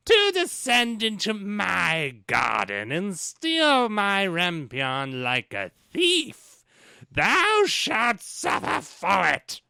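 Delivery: high, slow, loud mock witch; enchantress. The enchantress threatens the thief, slow high mock witch voice. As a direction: threaten him like a hammed-up sorceress. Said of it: "I guess I'll be the enchantress".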